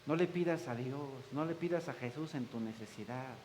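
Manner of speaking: soft, hushed voices telling you to stop praying; discouraging inner voices. Retold or imitated. Voices discourage you from praying, soft murmured hush. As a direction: murmur doubt into the listener's ear. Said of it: "escuchas voces que te dicen".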